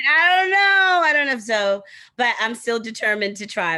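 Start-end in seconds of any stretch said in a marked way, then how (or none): 0.1-1.8 high, loud, despairing cry of not knowing; high and loud in mock despair; exasperated outburst. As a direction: wail it like you're stumped. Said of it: "And I was like".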